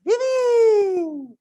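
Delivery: loud, high joyful call of her name; booming call. Retold or imitated. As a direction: boom her name out with delight. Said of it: "this is how I always respond".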